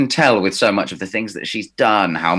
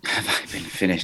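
whispered echo; 4 semitones lower, 7 dB quieter than usual. Real, not acted. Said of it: "incredulous echo of question".

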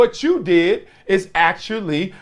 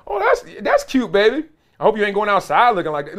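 put-on much higher, teasing old-lady voice; high, backhanded grandma compliment, sped up at the end; imitating an old grandma; 5 semitones higher, faster than usual. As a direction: play a sweet but stinging grandma. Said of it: "Old people always got a little sting".

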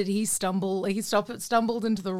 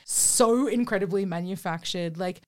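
ordinary delivery

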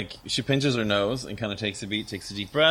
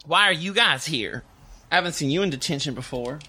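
a bit higher, quick curious question; Esther's voice; 5 semitones higher than usual. Acted. Esther asks the students why they are in detention. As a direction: ask brightly, rushing the second half. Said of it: "And she goes".

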